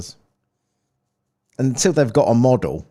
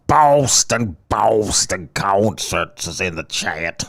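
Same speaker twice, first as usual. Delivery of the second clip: drawn-out, badly faked Boston accent; terrible Boston accent; much slower than usual. Shown as a demonstration. Host greets Boston Concerts in the chat, bad Boston accent. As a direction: stretch it out in a hammy mock Boston accent. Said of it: "That's a terrible Boston accent".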